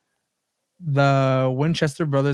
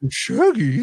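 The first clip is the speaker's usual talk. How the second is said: squeaky cartoon dog voice; 8 semitones higher, 4 dB louder than usual. Voicing Scooby calling Shaggy.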